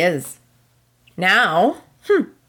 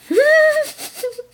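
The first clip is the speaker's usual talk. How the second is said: a high, shrieking burst of laughter; real helpless cackle; hysterical laugh. Real, not acted. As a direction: lose it completely, laughing almost to tears. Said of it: "this is hysterical".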